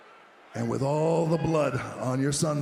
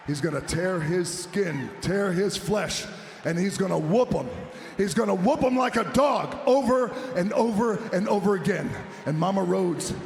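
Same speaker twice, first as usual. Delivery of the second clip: grind out the threat, then let it explode; building threat; 5 semitones higher, 4 dB louder than usual. Acted. Heel promo threatening opponent's mother.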